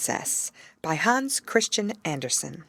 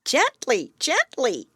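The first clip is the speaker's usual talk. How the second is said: high, louder bird voice pleading; raven protests; 8 semitones higher, 4 dB louder, slower than usual. Acted. Raven being hugged too hard asks Gerda to ease off. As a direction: ease her off, as a squeezed raven.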